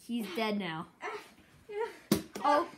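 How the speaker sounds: ordinary talk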